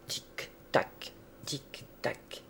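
hushed, toneless tick-tock, evenly spaced; ticking clock. A narrator's reading. Narrator imitates the big clock in the sleeping room, whispered ticking. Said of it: "La grosse horloge ronflait".

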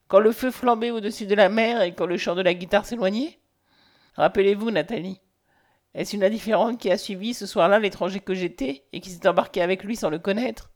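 ordinary delivery

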